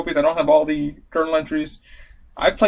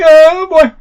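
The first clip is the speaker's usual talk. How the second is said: put-on high squeaky panicked oh boy; a gamer's yelp of dread; mock alarm; 12 semitones higher, 11 dB louder than usual. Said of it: "I'm like".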